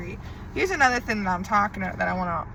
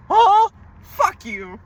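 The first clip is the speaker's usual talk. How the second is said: slow, much higher, louder curse; angry outburst; 10 semitones higher, 8 dB louder, much slower than usual. Real, not acted. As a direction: spit it out slowly and loudly. Lashing out at ex.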